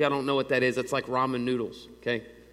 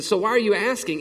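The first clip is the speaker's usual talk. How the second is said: higher and louder, alarmed; retold panicky question; 6 semitones higher, 5 dB louder than usual. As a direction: blurt it out in alarm. Young self panicking in storm.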